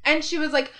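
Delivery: ordinary delivery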